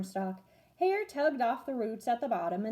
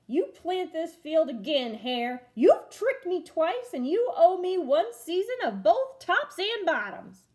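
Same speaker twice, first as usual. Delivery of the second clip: loud, slow angry demand; growling; 6 semitones higher, 6 dB louder, slower than usual. Acted. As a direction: growl out the order. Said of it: "Bear growled".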